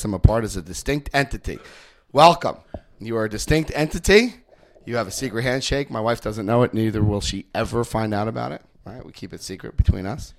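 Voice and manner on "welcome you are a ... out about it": ordinary talk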